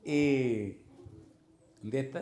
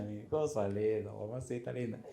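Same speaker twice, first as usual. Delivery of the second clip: soft low murmur of fondness; fond doting; 4 semitones lower, 6 dB quieter than usual. Real, not acted. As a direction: croon it like a besotted father. Doting on his baby son.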